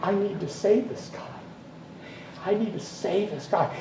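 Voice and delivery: hushed, deliberate inner resolve; quiet self-talk with pauses; retold inner thought. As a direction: think it aloud, softly and intently. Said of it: "she put in mind".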